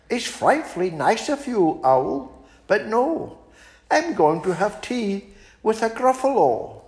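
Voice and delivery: high, polite little mouse turning down an invitation; small and prim, declining sweetly; a high, prim mouse fibbing to the owl. Acted. Mouse character voice.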